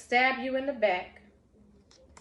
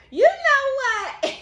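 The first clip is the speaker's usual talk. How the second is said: slow, high, laughing outburst; drawn out and laughing, half in disbelief; 14 semitones higher, 10 dB louder, much slower than usual. Real, not acted. Laughs at the fitting card.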